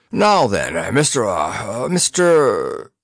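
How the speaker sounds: lofty, drawn-out hesitation; groping for the name, slowly; condescending fumble. A narrator's reading. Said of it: "nodded condescendingly".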